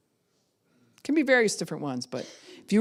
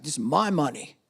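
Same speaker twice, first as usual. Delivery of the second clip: mock declaration, punching the key words; hammed-up teenage independence speech; defiant eighteen-year-old. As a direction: make a big, indignant announcement. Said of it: "eighteen-year-old who's like".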